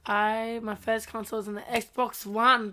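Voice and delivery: ordinary talk, nothing marked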